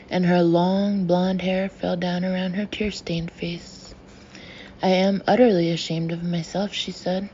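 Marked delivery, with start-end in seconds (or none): none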